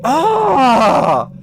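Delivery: a capped, half scream; restrained mock scream; held-back scream. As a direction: scream but hold it back. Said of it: "not gonna be committing to full-on screaming".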